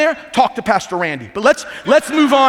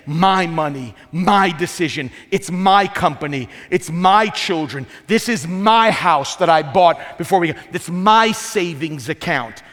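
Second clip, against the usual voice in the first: "my" hit hard on each item, possessive; stress on my; slower than usual. Retold or imitated. Pastor mimics a spouse, hitting each "my" hard. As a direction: lean on every "my" like a selfish spouse. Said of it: "It's dangerous to say".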